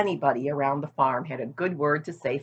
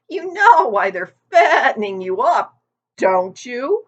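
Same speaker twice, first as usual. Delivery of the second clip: put-on higher, louder old ewe; a gossipy old sheep leaning in; 9 semitones higher, 9 dB louder than usual. A narrator's reading. Old sheep teasing Wilbur about his weight, higher and louder.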